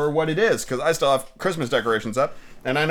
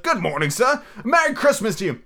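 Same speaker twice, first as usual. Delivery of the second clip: high, hearty street greeting; cheery passer-by; 9 semitones higher than usual. Acted. Good-humored fellows greet Scrooge in the street, cheery high voice. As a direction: call out a jolly holiday greeting.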